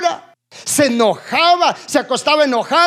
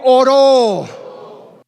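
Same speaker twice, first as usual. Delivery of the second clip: call and response. Shown as a demonstration. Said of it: "diga conmigo".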